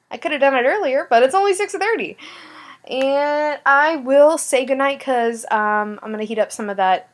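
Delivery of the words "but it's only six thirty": "only" stretched high and amused; stress on only. Real, not acted. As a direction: lift "only" in a high mocking voice. Repeats the early hour.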